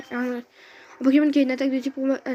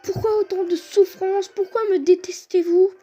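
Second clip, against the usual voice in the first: high sarcastic exasperation; raging gamer; 5 semitones higher, 3 dB louder than usual. Real, not acted. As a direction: rant sarcastically at bad luck. Sarcastic rage at game luck.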